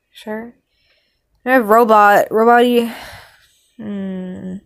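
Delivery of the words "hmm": ordinary talk, nothing marked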